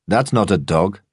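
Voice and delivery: lightly voiced mocking gym brute; weightlifter's sneer. A narrator's reading. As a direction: sneer it down at the little dog like a bench thug. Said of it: "the weightlifter said".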